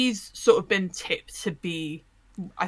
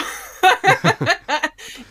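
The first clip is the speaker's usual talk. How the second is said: a loud burst of high laughter; big laugh; 11 semitones higher, 8 dB louder than usual. Real, not acted. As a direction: laugh out loud at your own joke. Laughing at own joke.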